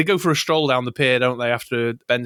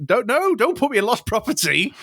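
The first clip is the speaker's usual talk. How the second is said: higher-pitched imitated pleading; mock protest; 9 semitones higher than usual. Retelling the robot's plea to avoid lost property, mock-distressed. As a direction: plead like an indignant robot, hammed-up.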